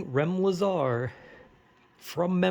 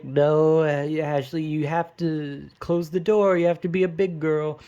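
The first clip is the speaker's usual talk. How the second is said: stern mother voice; 3 semitones higher, 4 dB louder than usual. Retold or imitated. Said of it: "She's like".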